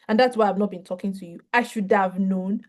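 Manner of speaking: usual speaking voice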